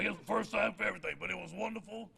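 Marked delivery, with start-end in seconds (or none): none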